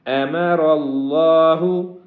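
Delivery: أَمَرَ اللَّهُ with a heavy ر and a full اللَّهُ; slow, heavy letters; heavy letters clearly shown. Shown as a demonstration. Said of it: "را کو بھی موٹا کریں گے".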